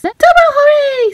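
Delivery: high, loud double cheer; excited cheer. A narrator's reading. Little sister cheering at the costume contest news, excited.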